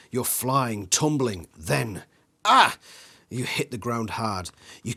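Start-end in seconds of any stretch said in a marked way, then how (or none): 2.4-2.8 sudden high yelp as you hit; sharp cry. A narrator's reading. A kid falling in the woods yelps out. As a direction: yelp of a kid tumbling to the ground. Character falls hard.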